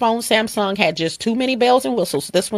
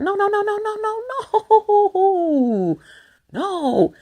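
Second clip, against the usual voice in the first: high, drawn-out sing-song no; emphatic no; 12 semitones higher, much slower than usual. Real, not acted. Rejecting viewers' objection, with a high, drawn-out no. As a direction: stretch every no out to shut the idea down.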